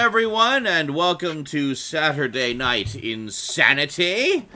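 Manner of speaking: loud, slow show-host greeting; announcer voice. Acted. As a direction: open the show like a big announcer.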